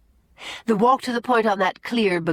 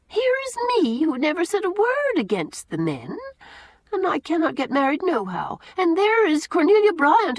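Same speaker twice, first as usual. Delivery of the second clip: higher, gossipy housekeeper voice; lightly raised chatty Susan; 7 semitones higher than usual. A narrator's reading. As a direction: chatter on like a homely old servant. Susan complaining of unfairness.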